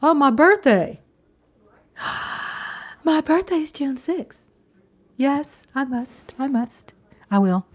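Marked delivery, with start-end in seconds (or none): none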